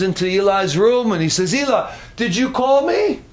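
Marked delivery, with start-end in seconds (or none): 1.5-3.2 lightly voiced boy, pitched up; a hint of an eager child, rising at the end; questioning boy. Boy runs in to ask old Eli, lightly voiced higher question.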